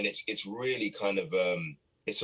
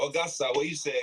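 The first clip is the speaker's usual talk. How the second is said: put-on Nigerian accent; 6 semitones higher than usual. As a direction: tease her in a Nigerian accent. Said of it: "like, your Nigerian-ish".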